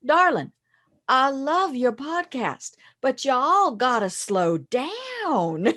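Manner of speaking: put-on slow Southern accent, drawn out; slow Southern accent, lovingly teasing; Southern drawl. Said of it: "this person came up to me and he said".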